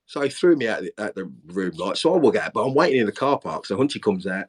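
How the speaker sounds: normal talking voice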